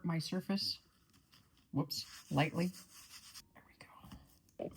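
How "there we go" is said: whisper. Real, not acted. Soft aside while flouring.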